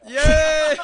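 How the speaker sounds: loud, high celebratory whoop; excited cheer. Real, not acted. Hosts celebrate getting guest on line.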